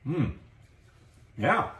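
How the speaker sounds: chewing while talking; muffled through food; mouth full of food. Real, not acted. Seen 1: Bite taken at -2.0; jaw still working while wiping hands.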